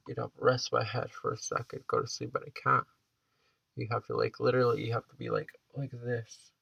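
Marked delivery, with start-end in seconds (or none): none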